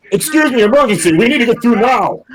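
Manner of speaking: very high, loud urgent cry; blurting out. Acted. Peck pushing through the crowd in an emergency, blurting loudly. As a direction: clear a path with urgent high-pitched shouts. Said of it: "Peck is occasionally blurting out".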